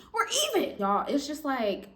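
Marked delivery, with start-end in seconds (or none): 0.1-0.8 high pointed tag; high, pointed finish. Retold or imitated. Quoting herself, pointed.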